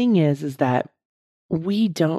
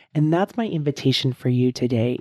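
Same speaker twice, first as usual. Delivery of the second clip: ordinary delivery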